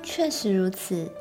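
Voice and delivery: usual speaking voice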